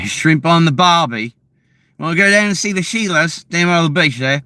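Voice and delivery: high, broad mock Australian; Australian accent. Shown as a demonstration. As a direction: put on a cheery-caricature Aussie accent. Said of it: "The Australian accent".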